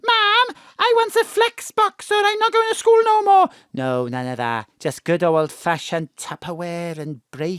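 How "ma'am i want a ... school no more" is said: much higher, louder mock whiny kid; whining child. Mam mocking today's kids, in a high whiny child voice. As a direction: mimic a spoilt child nagging mum for a toy.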